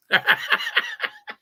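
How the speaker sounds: high, hearty burst of laughter; long high cackling laugh; real laugh. Real, not acted. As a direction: crack up at your own joke. Laughs at own God joke.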